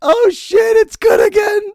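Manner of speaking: high squeal through laughter; cracking up. Real, not acted. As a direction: barely get it out through laughter. Laughing at the replayed clip.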